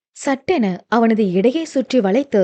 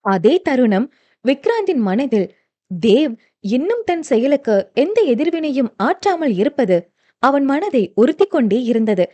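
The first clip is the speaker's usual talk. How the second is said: ordinary delivery, nothing marked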